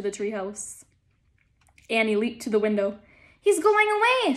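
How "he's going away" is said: out of breath and excited; breathless from the climb; panting. Acted. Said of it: "she said, panting".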